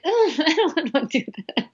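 an exaggerated ugh with a laugh, owning up; groaning reluctance. Mom recalling early motherhood, laughing groan of reluctance. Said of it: "I was like".

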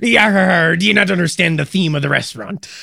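high, loud pirate growl. Pretend. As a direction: play an indignant pirate. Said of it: "talk like a pirate".

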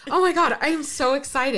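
high, loud, with a laugh; bubbling over with joy; excited, laughing. Real, not acted. To her friend after opening the gift, laughing with joy.

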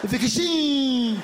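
"Vacation" with a long, high final syllable; teasing wrong stress. Acted. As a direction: rush into a drawn-out last syllable of "Vacation". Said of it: "Just to mess with him".

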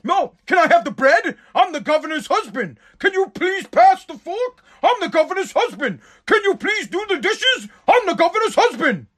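high, sneering mock of a wife. Wife mocking husband at dinner.

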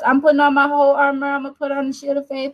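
limp, rushed mock declaration with no conviction; weak sauce. Preacher mocking a churchgoer's feeble armor prayer, fast and limp. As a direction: rattle it off fast like you don't mean it. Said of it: "with that weak sauce".

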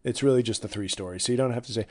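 usual speaking voice

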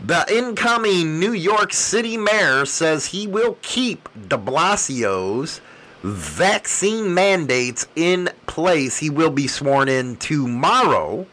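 ordinary talk

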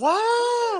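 high, loud sustained shriek; mock scream. Re-enacting her answer to favorite color question, screaming. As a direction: scream it out, hammed up for laughs. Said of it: "screaming at the top of my lungs".

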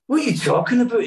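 high, quick retort from an imitated comedian; Billy Connolly. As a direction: retell the comedian's puzzlement, fast and high. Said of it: "And he's like".

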